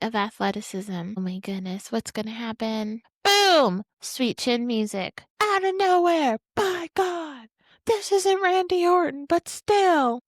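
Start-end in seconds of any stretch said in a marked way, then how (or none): 3.2-3.8 loud, high burst like a commentator; excited call. Real, not acted. Calling the finisher. 5.4-10.2 high, loud mock commentator shout; commentator call. Imitating wrestling commentator call.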